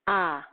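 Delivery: a sounded out alone, stressed; isolated vowel sound. Shown as a demonstration. Teacher gives the child the answer sound. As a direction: hold a as a clear open vowel. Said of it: "On entend le son".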